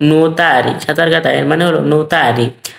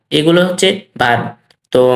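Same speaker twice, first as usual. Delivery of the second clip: usual speaking voice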